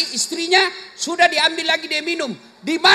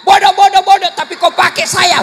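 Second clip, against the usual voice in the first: mock insult; 6 semitones higher, 9 dB louder than usual. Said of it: "Dia bilang istrinya".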